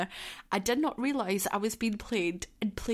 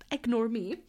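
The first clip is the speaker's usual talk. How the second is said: normal talking voice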